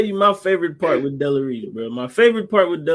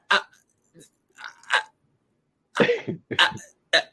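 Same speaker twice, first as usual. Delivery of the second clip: long halting stammer with big gaps; imitated stutter. Champ asked if he's ready to win, hammed-up stutter. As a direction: get stuck on the first word, then push through.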